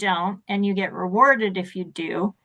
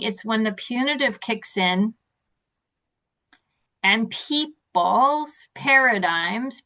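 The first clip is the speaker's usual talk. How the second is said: ordinary delivery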